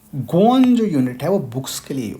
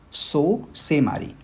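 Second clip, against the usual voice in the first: "सो से मारी" drawn out very slowly; slow Korean model; 3 semitones higher, much slower than usual. Shown as a demonstration. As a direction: spell out each syllable of "सो से मारी". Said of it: "ऐसे करके बोलेंगे". ("सो से मारी" बहुत धीरे)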